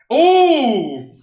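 high, loud impressed ooh; excited ooh. Real, not acted. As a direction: react to the pull like it's gold. Reacting to a good pull.

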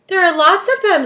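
high, loud little bug voice; ladybug voice. A narrator's reading. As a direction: pipe up like a tiny ladybug. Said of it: "said the ladybug".

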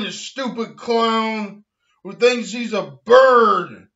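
normal talking voice

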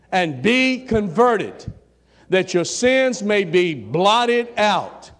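slow, loud, deliberate proclamation; each phrase stretched and declaimed to the congregation; slow scripture reading. Real, not acted. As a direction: read it out slowly so nobody misses it. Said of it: "I'm gonna read it slow".

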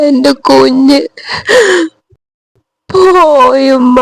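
slow, sobbing words; crying. Acted. Daughter telling her mother she lost the baby, sobbing slowly. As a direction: drag each word out through tears. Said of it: "നീ കരയാണ്ട് കാര്യം പറയ്".

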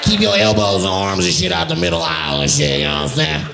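mock safety speech in the man's odd voice; pilot with that voice. Comic imagining the man briefing passengers, mocking imitation. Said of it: "the pilot's like".

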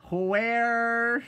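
high, loud and drawn out; loud shocked where. Shown as a demonstration. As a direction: wail it like a shocked question. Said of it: "I'm gonna say".

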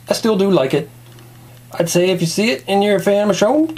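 normal talking voice

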